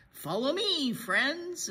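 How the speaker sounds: rally the group forward; Donkey proudly leading the way; eager leader. A narrator's reading. Donkey leads the friends.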